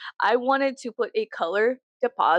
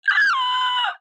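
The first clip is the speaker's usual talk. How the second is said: high, sharp squeal of joy; excited scream; 3 dB louder than usual. Retold or imitated. On getting the tour email at night, a squealing shriek. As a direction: squeal like you just got the news. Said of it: "I got really excited".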